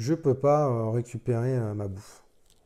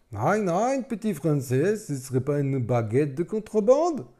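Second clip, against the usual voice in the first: high, loud mock German soldier accent; bad German accent; 6 semitones higher, 7 dB louder than usual. German soldier bullying the resistance fighter, bad German accent. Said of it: "je fais super mal l'accent allemand".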